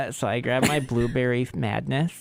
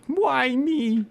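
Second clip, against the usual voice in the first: high, whiny self-pity; 13 semitones higher than usual. Retold or imitated. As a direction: whine it like you were wronged.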